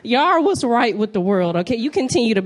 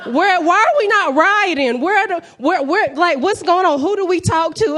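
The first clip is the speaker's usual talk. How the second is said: high, louder disbelieving rant; high frantic rant; 7 semitones higher, 3 dB louder than usual. Retold or imitated. Comic to crowd about price hikes, high frantic rant. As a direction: rant up high as if no one cares.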